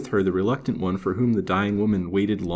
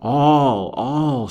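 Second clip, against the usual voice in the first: drawn-out, loud hollow moan; 5 semitones higher, 6 dB louder than usual. A narrator's reading. Dying woman admits her guilt, hollow and anguished. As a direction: let each word swell higher, then fall hollowly. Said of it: "she murmured hollowly".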